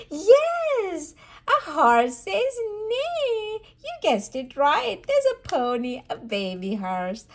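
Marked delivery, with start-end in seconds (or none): 0.1-3.6 high, loud happy reveal; excited reveal. Real, not acted. Mother cheering the horse reveal for toddler.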